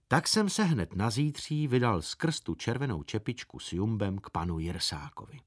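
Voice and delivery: usual speaking voice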